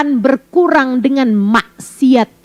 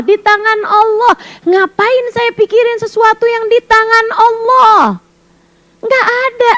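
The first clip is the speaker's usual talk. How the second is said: normal talking voice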